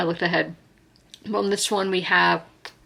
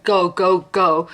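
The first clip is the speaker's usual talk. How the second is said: mock firemen yelling; 6 dB louder, slower than usual. Firemen urging people out.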